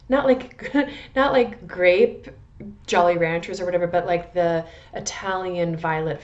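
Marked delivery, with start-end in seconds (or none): 0.1-2.3 slow, laughing through not like grape. Real, not acted. Describing the scent to viewers, laughing slowly. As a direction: laugh it out, stretching the words. Laughing at her own candy comparison.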